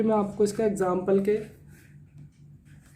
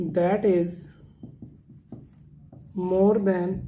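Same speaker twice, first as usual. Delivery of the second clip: slow pauses between phrases; slow chunked dictation; 5 dB quieter, much slower than usual. Shown as a demonstration. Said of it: "dictates phrase by phrase".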